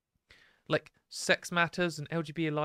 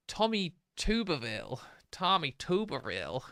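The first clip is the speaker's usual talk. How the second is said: badly faked American accent; terrible American accent. Streamer naming the guy in the clip, mock American accent. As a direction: put on a hammy American accent. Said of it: "that was a terrible American accent".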